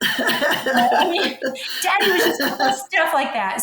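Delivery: laughing through the words, voice lifted high. Real, not acted. Remembering daddy's joke, speaker laughs while talking. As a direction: giggle it out fondly.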